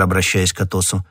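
usual speaking voice

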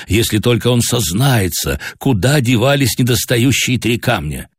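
usual speaking voice

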